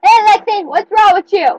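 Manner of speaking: loud, high yell at a sibling. Real, not acted. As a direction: shout it in his face. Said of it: "don't yell at him".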